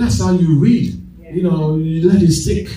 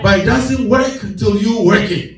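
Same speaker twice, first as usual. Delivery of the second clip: higher, rhythmic sermon cadence; preaching; 7 semitones higher than usual. Acted. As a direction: preach it to the amen corner. Preaching with congregation amens.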